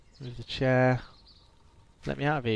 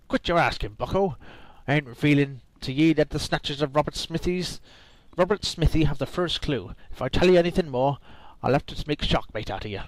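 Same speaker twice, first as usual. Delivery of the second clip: put-on pushy pirate accent, a bit louder; 3 semitones higher, 5 dB louder than usual. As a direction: bark it like a grumpy old pirate. Reading pirate NPC lines.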